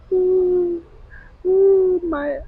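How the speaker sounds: high, wailing imitation of childish crying; mock crying wail. Retelling how she cried for her dad as a kid, a high mock wail. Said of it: "I would cry like that".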